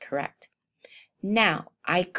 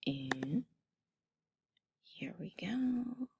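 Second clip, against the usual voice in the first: whispered; 3 semitones higher, 13 dB quieter, slower than usual. Real, not acted. About to click save.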